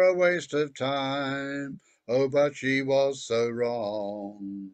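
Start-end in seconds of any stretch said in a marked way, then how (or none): none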